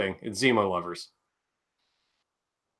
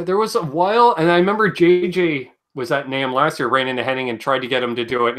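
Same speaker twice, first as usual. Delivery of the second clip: normal talking voice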